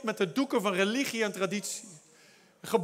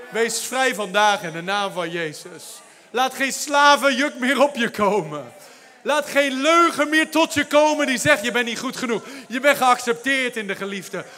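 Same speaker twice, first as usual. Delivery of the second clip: preaching; 2 semitones higher, 7 dB louder than usual. Real, not acted. Preaching, declaring freedom.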